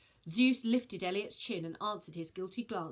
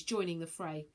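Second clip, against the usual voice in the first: plain reading voice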